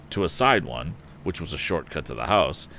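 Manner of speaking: usual speaking voice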